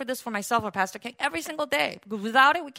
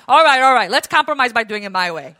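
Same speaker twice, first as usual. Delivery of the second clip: loud, bossy all right, all right; hammed-up bossy compromise, louder; mock surgeon's attitude; 9 dB louder than usual. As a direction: bark it like a surgeon who always wins. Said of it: "It's a surgeon's attitude".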